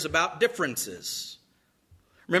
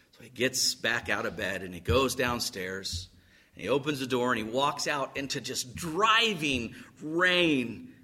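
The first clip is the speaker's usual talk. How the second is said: usual speaking voice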